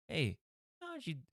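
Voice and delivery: very high, soft puzzled question; baffled officer. Acted. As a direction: squeak it out, baffled. Cop asking magician.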